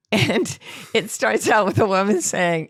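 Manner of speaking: ordinary talk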